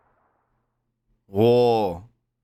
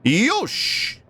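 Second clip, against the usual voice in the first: excited cheer; 10 semitones higher than usual. Real, not acted. Dealer busts, he cheers.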